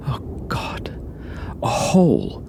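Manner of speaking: plain reading voice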